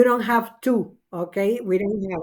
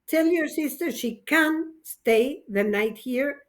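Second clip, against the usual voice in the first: ordinary talk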